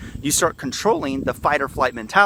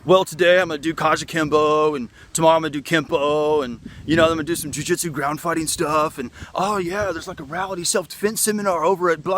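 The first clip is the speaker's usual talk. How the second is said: lightly higher voice mocking a style-hopper; mocking flighty student; 4 semitones higher than usual. Mocking students who hop between martial arts, light sing-song. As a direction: breeze through the list like a dabbler with no focus. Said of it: "they're like".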